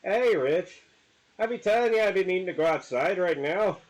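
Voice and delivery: a pushy Irish dog demanding out; Irish accent dog. Pretend. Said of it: "since they do have Irish".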